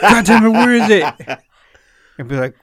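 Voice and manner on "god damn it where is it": high, loud burst of exasperation; mock angry yell. Imagining the Lego owner discovering it gone, mock angry yell. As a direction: yell it in hammed-up outrage.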